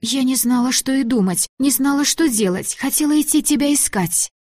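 a hint of a scared granddaughter; young girl voice. A narrator's reading.

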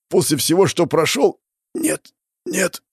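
high, anguished, fading into weak refusal; desperate disbelief. Acted. Hero dying after all he went through, desperate plea. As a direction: protest death with the last strength.

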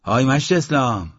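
loud call. A narrator's reading. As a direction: hail someone from a distance. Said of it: "با صدای بلند گفت".

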